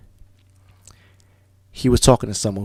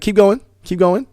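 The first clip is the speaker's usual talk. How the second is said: higher, louder call pushing him onward; urging shout; 10 semitones higher, 9 dB louder than usual. Retold or imitated. Mentor urging man into ocean.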